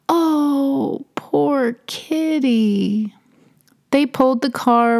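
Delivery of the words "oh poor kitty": sad pity. Acted. Bonnie sees her dead cat.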